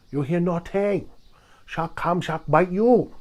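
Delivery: mock Chinese accent with broken English. Comedian arguing sharks make no sound, in a Chinese accent. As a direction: put on a hammy Chinese accent. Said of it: "I can do that Chinese accent".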